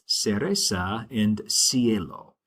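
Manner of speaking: cereza and cielo starting with an S sound, slow; soft C sound. Shown as a demonstration. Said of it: "it's actually a soft sound".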